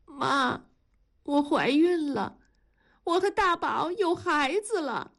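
high, emotional confession rising in pitch; tearful. A narrator's reading. Daughter-in-law telling mother-in-law she is pregnant, tearful. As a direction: break the news through tears. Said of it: "流着泪说".

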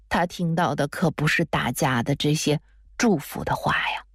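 ordinary delivery, nothing marked

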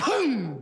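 imitated explosion. Storyteller imitating the firecracker going off, booming. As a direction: sound out a big bang.

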